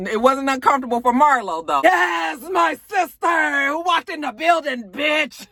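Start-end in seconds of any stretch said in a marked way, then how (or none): 1.8-5.5 higher, slower, half-shouted gloat; mock excited cheer. Marlo gloating as Nene walks in, mock excited shout. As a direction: crow it out like a thrilled sister. Retold Marlo greeting Nene's entrance.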